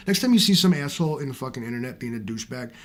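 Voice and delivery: ordinary talk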